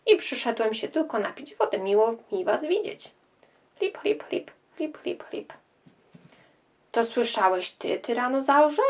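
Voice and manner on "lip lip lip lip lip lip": soft lapping sounds, fading away; lapping water. Acted. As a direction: lap at the water quietly. Dinosaur drinking water.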